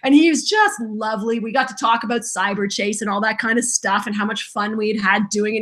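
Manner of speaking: normal talking voice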